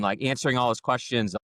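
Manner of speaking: ordinary delivery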